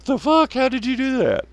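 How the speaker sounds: very high incredulous squeak; stunned disbelief. Real, not acted. Streamer reacting to a silly weapon, high shocked squeak. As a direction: pitch it way up in shock. Reacting to absurd grenade launcher.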